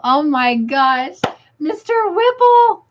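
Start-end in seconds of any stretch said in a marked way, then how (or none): 1.6-2.8 much higher, squealed name; delighted squeal of the name; delighted repeat. Real, not acted.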